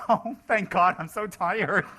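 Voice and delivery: high, loud, laughing mock relief after the talk; mock relieved sob. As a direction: play the exhausted speaker, squealing with relief. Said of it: "she's crying".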